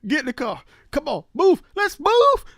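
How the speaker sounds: rushed, loud, climbing to a high yell; rally everyone to move right now; urgent shouted orders. Acted. Acted hurried police-style ordering.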